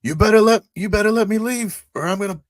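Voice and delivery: high, loud in-character warning; shouted threat. Acted. Pilot threatening to blow up stuff, shouted threat. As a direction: shout it as an ultimatum.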